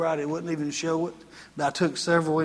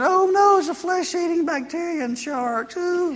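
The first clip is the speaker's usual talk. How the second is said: mock panic; 11 semitones higher than usual. Pastor mocking ocean fears in a high mock-panicked squeal. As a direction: shriek like a scared beachgoer.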